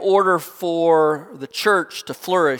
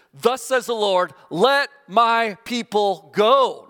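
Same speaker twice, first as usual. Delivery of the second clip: proclaiming command; 6 semitones higher, 3 dB louder, slower than usual. Retold or imitated. Moses proclaiming to Pharaoh.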